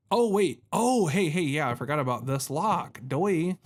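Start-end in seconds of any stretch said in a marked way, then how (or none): none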